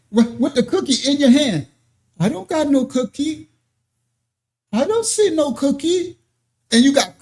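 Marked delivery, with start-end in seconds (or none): 2.2-6.2 high-pitched playful mock child voice; child denying it. Preacher voicing a child caught with a cookie, mock innocent child. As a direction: play the fib-telling kid, innocent and insistent.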